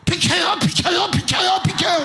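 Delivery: high, loud panicked yell; yelling skier. Retold or imitated. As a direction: shout them out of the way while hurtling downhill. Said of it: "내려오면서 이래요".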